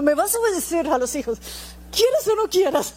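a hint of a cross mother, rising high on quieras o no quieras; mock angry parent. Mother scolding her kids, lightly hammed-up mock anger. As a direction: playfully imitate a bossy mom laying down the law. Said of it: "ya está uno muy bravo".

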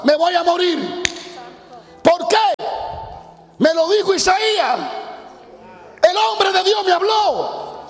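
a dying king, voice rising in alarm; frightened king. Acted. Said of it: "Le dice a la esposa". (rey asustado hablando más agudo a su esposa)

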